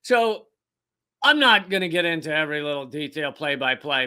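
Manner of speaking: usual speaking voice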